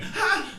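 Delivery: sharp high yelp of sudden pain. Retold or imitated. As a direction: bark a short high cry of pain. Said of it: "I did it again".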